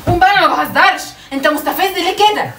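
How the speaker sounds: loud, high angry order to leave; furious. Real, not acted. Wife ordering husband out of the house, furious and loud. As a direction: throw him out, you're not joking.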